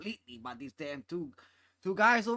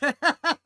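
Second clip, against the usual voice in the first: loud laugh; 10 semitones higher, 11 dB louder than usual. Real, not acted. Laughs at own beer joke.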